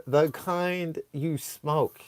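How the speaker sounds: slow, soft deadpan; hushed, drawn-out stupid reply; dumb answer. Retold or imitated. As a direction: draw it out quietly, mocking your own stupidity. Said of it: "My dumb ass said".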